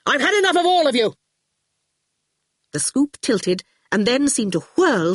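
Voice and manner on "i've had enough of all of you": high, fast, furious yell. A narrator's reading. Archer rages at all his visitors, fast high yell. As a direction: rattle it off in a rage. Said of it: "he yelled".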